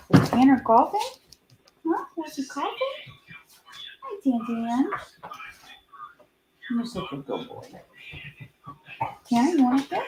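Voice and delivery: high cooing to a pet; talking to a dog. Real, not acted.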